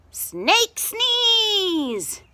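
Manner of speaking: high, loud chanted cheer; crowd shouting. A narrator's reading. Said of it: "shouted the crowd".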